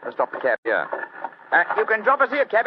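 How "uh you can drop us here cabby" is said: raised, louder call; hailing a driver; calling to cabby. Acted. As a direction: call up to the cab driver, high and loud.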